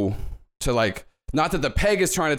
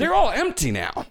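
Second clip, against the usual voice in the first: bellhop voice; 11 semitones higher than usual. Acted.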